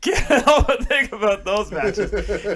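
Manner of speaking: slow, laughing through the words; laughing defense of a joke pick. Real, not acted. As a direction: chuckle as you gush. Laughs through his own pick.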